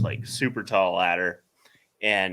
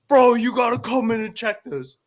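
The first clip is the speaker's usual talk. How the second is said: excited call; 13 semitones higher, 8 dB louder than usual. Retold or imitated. Movers urging him over.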